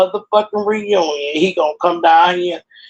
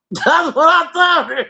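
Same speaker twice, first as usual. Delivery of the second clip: high-pitched, laughing through the words; 13 semitones higher than usual. Real, not acted. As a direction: break up laughing as you say it. Laughing at her own retort.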